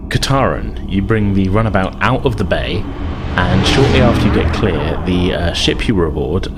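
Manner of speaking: usual speaking voice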